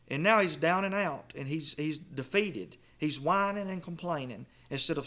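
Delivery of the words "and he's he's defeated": ordinary talk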